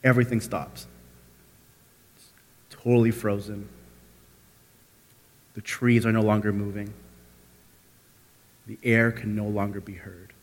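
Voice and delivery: soft, low narration with long pauses; hushed suspense. A narrator's reading. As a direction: let everything hang frozen in stillness. Story freezes after the scream.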